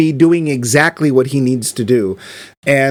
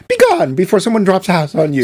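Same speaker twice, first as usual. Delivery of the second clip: mock Glinda command; 4 semitones higher, faster than usual. Host imitating Glinda shooing off the witch, mock-imperious. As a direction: imitate Glinda dismissing the witch with a wand wave. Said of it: "waves her wand and says".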